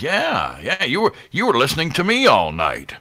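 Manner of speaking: high, hammed-up radio jock voice; imitated self-important DJ; Todd Hager. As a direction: announce yourself to a stranger like you're on air. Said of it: "He would start talking like that".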